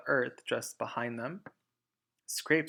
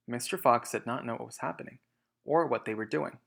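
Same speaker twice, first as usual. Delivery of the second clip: ordinary delivery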